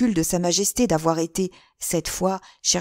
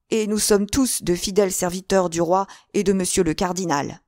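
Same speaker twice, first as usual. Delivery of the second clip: plain reading voice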